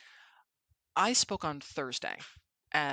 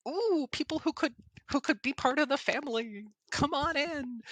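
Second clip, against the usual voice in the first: much higher, delighted sing-song; gushing welcome; 11 semitones higher than usual. Retold or imitated. Inviting speakers into the family, high and gushing. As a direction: welcome them in with glee. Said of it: "I'm just like".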